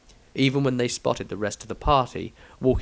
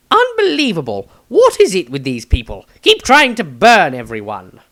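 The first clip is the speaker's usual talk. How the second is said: high, loud prissy gentleman's voice; a high theatrical fop; high mocking Maestro; 7 semitones higher, 8 dB louder than usual. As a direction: play him high and pleased with himself.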